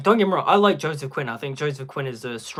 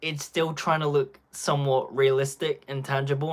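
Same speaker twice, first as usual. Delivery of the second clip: normal talking voice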